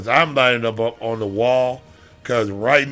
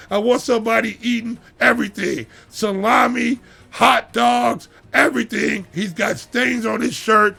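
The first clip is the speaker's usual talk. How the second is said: raised, higher-pitched rant about hungry coaches; fired-up rant; 8 semitones higher than usual. Real, not acted. Host rants to the audience about coaches, fired up. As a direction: get worked up and let it rip. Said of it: "with that outburst".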